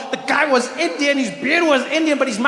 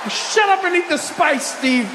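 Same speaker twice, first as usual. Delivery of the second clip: mock scolding; 6 semitones higher than usual.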